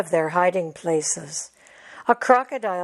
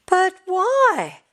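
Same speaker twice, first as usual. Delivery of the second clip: high pleading cub question; a cub who can't understand; upset cub; 11 semitones higher than usual. Acted. Tejas pleading with his mother, high. Cub protesting felled trees.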